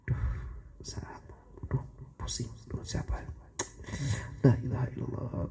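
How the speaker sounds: soft unvoiced whisper, fretting; whispered prayer fret. Worshipper buzzing phone in prayer, anxious whisper. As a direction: whisper it, distracted mid-prayer.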